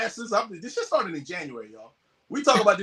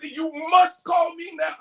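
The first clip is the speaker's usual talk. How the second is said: retold frantic shout; 9 semitones higher, slower than usual. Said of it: "He's going crazy".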